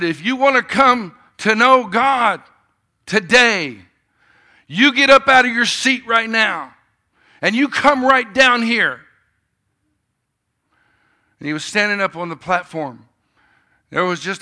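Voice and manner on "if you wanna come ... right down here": much higher and louder, slow, commanding; preacher's altar call. Retold or imitated.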